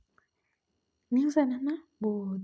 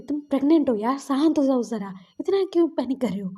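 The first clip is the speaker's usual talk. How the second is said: louder, exasperated acted scolding; mild shouting; 3 semitones higher, 5 dB louder than usual. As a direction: raise your voice to make them calm down. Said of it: "थोड़ा चिल्ला कर बोल दिया था". (थोड़ा चिल्लाकर, झुंझलाते हुए)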